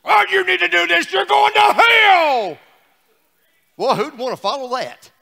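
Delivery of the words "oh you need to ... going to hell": mock fire-and-brimstone yell; hellfire preacher. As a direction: holler it like a hellfire preacher, slowing at the end. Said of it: "don't hear us preaching like this".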